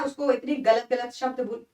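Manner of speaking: ordinary talk, nothing marked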